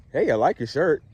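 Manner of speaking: compliment with the pitch kept up; up-talking compliment. Shown as a demonstration. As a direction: pay a compliment without letting the voice drop. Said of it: "I would talk like that".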